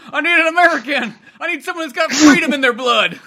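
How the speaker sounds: high, loud hammed-up tourist panic; mock panicked tourist; clueless American tourist. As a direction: play a lost loud American.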